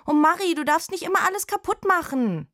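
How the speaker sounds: high, loud scolding call. Acted. Jenny calling reproachfully to Marietta next door. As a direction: call it through the wall. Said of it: "ruft sie".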